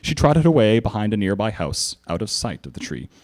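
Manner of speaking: plain reading voice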